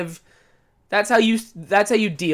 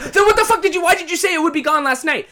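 high, loud exasperated demand; mock exasperation; 9 semitones higher, 4 dB louder, faster than usual. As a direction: demand an answer in disbelief. Exasperated retort.

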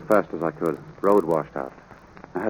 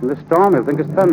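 higher, louder, insisting over her; husband arguing louder with his wife over shooting the filly; raised voice; 9 semitones higher, 7 dB louder than usual. Acted. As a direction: push back at his wife, voice up.

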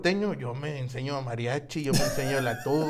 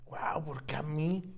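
whispered; 7 dB quieter, slower than usual. Retold or imitated. Imitating own disbelief at fans.

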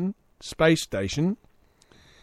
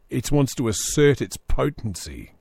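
usual speaking voice

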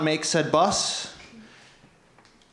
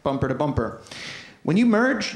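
ordinary talk, nothing marked

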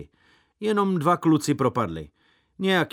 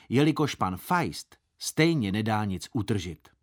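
ordinary delivery

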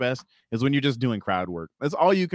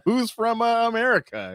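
high, hesitant rallying call; mock crowd pandering; 12 semitones higher, 3 dB louder than usual. Failing comic appealing to foreign audience, high mock-peppy call. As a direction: fish for a cheer that will not come.

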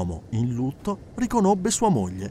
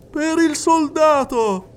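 high, loud, slow announcement; calling out; 13 semitones higher, 6 dB louder, slower than usual. Acted. Medium calling out to the soldier, high and loud. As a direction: call across the room to the door.

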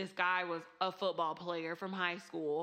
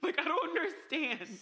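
laughing disbelief; 10 semitones higher, 4 dB louder than usual. Real, not acted.

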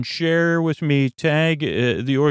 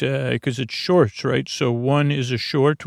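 normal talking voice

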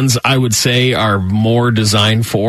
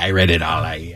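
lower, offhand dad voice, dropping away softly; imitating his dad; 8 semitones lower, 6 dB quieter, faster than usual. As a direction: play an unbothered dad owning up, pitched down. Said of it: "He's like".

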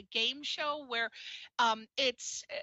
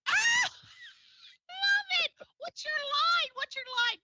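high, loud, eager shouting; excited shout; 3 dB louder than usual. Real, not acted. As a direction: cheer him on to say his line. Eagerly urging him to recite.

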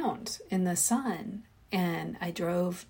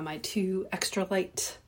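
normal talking voice

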